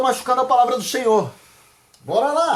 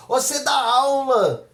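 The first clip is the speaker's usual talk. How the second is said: slow, higher-pitched challenge; incredulous questioner; 4 semitones higher, slower than usual. Acted. Someone presses the pastor about teaching, incredulous questioner.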